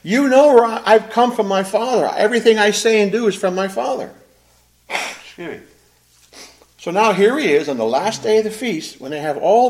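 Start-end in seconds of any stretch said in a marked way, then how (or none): 0.0-4.1 loud, higher proclaiming voice; retold shout. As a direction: proclaim it loudly over the crowd. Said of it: "he shouted out to the crowd".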